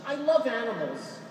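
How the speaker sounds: a lightly voiced Long Island woman gushing; the woman in fur tells the friar, in a light Long Island accent. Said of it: "That's a Long Island accent".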